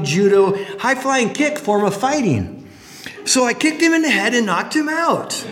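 half-hearted imitation of a friend bragging, chuckling; [name] retelling. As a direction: pitch it up and play him proud of his kick, barely hinting at his voice. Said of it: "he told us in his delightful North Indian accent".

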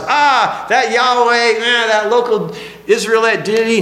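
high, sneering dismissive toast; mocking Babylonians. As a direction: scoff at a foreign god over wine. Babylonians mocking Israel's God.

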